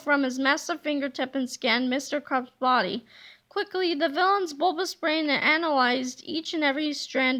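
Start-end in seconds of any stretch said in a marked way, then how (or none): none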